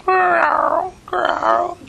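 high growly bear-cub noise; cub growl. Acted. Polar bear cub growls up at the boy, high and playful. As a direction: growl up at him like a little cub. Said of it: "looks up at him and".

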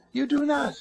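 high, emphatic no; sharp denial. Real, not acted. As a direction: cut them off sharply. Preacher sharply contradicts listener.

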